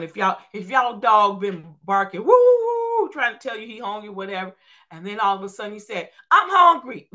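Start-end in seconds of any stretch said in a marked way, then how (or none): 2.2-3.0 high, loud mock dog bark. Preacher imitating a barking dog, high and loud. 6.3-7.1 loud, high squeaky dog voice; talking dog. Retold or imitated. Preacher voicing a talking dog, loud and high. Dog suddenly talks in story.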